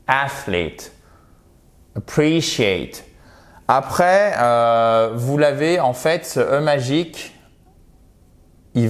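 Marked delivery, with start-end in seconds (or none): 0.1-3.0 Athlete and Appreciate said slowly with long I vowels; English athlete and appreciate. Shown as a demonstration. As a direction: model Athlete, Appreciate clearly. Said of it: "Vous avez votre accentuation ici". (Athlete, Appreciate, voyelles longues)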